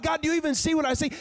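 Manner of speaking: mock screaming at God. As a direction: hurl the question at God. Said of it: "He was screaming at God".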